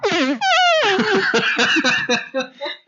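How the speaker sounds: squeaky fart noise; making fart noises. Real, not acted. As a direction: blow a high squealing fart sound. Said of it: "That was a squealer".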